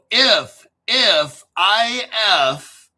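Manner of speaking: loud, slow spelling of "if" and "I F"; shouted spelling. Acted. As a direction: spell out "if" loudly, like to a child. Mock spelling shouted at viewers.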